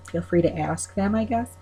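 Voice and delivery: normal talking voice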